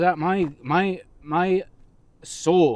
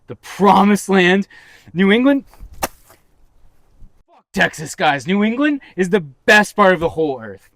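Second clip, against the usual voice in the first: louder and higher, a hometown booster's rallying cry; fired up; 5 semitones higher, 8 dB louder than usual. Real, not acted. Host brags about New England, fired up and loud. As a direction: pump up the crowd for New England. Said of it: "I don't wanna get too fired up here".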